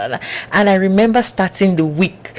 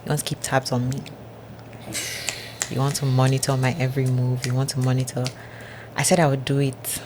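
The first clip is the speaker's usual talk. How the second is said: much softer, hushed voice of God; God's calm voice; 2 semitones lower, 8 dB quieter than usual. Retold or imitated. God's voice quoted.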